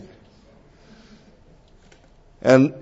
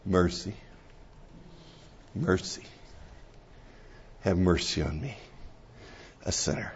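soft mercy; 8 semitones lower, 20 dB quieter than usual. Retold or imitated. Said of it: "And God says".

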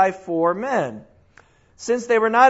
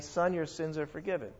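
soft rabbi's pronouncement; 4 semitones lower, 12 dB quieter than usual. Retold or imitated. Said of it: "Here's this rabbi saying".

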